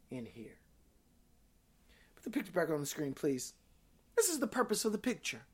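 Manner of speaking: soft, reflective questioning with long pauses; hushed preaching appeal. Real, not acted. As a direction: let each question sink in quietly.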